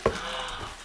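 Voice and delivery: high, soft, airy gasp; a quiet gasp of awe. Real, not acted. Admiring the pink shoes, a soft gasp. Still gushing over shoes.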